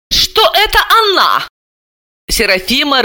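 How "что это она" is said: high-pitched astonished query; shocked question. A narrator's reading. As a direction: squeak it out in disbelief. Shocked relative in family confrontation.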